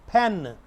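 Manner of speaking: "pen" with a puff of air on the p; aspirated p. Shown as a demonstration. Teacher modelling "pen" for students, p clearly aspirated. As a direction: release the p of "pen" with extra breath. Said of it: "प की बजाय इसको फ आपने पढ़ना है".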